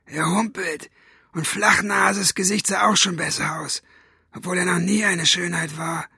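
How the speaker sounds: high, rough villain's voice; rough cat-tongue voice. A narrator's reading. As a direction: rasp it like a cat's tongue. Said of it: "die Katzenstimme". (raue, hohe Katzenzungenstimme)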